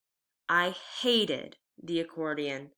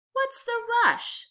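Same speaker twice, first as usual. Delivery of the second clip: teasing sister; 3 dB louder than usual. Acted. Renee teases Dad.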